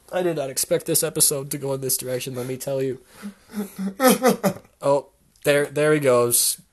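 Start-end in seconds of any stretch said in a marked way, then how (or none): 3.2-4.6 high, loud mock sobbing; hammed-up wailing sobs; crying. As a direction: bawl in bursts. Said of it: "crying all over the place".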